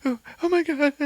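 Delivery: high, panicky exclaim; terrified. Retold or imitated. Lisa seeing the monster on camera, high panicky exclaim. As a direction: shriek in fright. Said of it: "she's just like".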